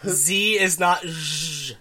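"Z" named plainly, "zh" as a long buzz; contrasted sounds. Shown as a demonstration. Arguing they're different phonemes, contrasted sounds. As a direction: hold out "zh" as in vision, slowly. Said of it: "They're different phonemes".

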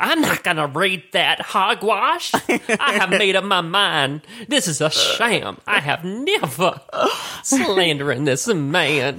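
slow, hammed-up indignant politician; Lindsey Graham. Politician refusing to read the transcript, slow mock indignation. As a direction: draw it out in mock outrage. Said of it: "they released it to".

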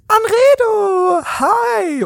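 high, drawn-out squeal of a name; high and very slow hammed-up greeting; gushing Lisa greeting. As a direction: greet an old classmate with exaggerated delight. S1 plays Lisa greeting at party.

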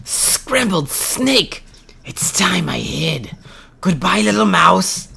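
high slow cry then a hushed whisper; startled snake. Acted. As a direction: snake slipping away scared.

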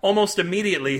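usual speaking voice

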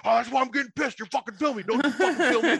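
high-pitched imitation of an irate man; mock-furious protest; acted angry rant. Acts homeless man ranting at cop.

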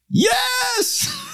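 very high, drawn-out mock yell; imitated yell. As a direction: yell it out like a heckler at the back of a room. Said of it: "somebody in the back of the room who yelled".

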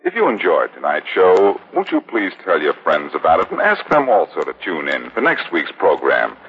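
normal talking voice

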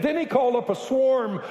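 rising to high-pitched shouted exclamations; excited preaching, building. Real, not acted. Preacher recounts the swarm of frogs, building to shouts. Animated climax of frog plague story.

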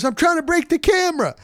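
joking reply. Retold or imitated. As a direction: toss back a joke, pitched high. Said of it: "And he goes".

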